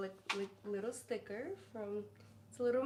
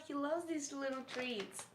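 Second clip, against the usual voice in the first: normal talking voice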